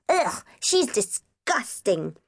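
put-on slow, drawn-out child's disgust; disgusted boy. A narrator's reading. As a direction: pull a face as you say it. Davy pulls a face.

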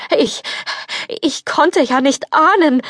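high, halting frightened excuse; stammering fear. Acted. Prince stammers his excuse to the furious fairy. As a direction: stumble over the words in panic.

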